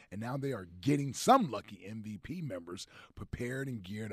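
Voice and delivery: upbeat radio ad read, big finish. Acted. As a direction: sell it like a sponsor spot. Sponsor ad read on air.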